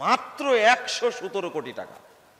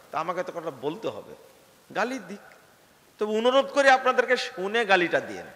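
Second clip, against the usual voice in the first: usual speaking voice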